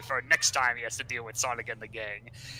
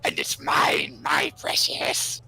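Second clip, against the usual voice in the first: lower, slow half-whispered Gollum voice; 11 semitones lower, much slower than usual. Retold or imitated. Cubot hoarding the hammer, whispered Gollum impression. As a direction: hiss it like Gollum clutching treasure. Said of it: "enemy, Gollum".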